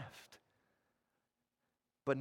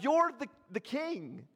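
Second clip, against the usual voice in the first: high, hopeful acclaiming cry; crowd shout; 8 semitones higher than usual. Acted. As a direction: hail him as king. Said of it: "they're shouting out".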